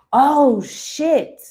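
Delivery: sharp gasp, then a high, loud "oh shit"; shocked gasp. Real, not acted. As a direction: caught off guard by the card, gasp it out. Sudden shocked reaction to card.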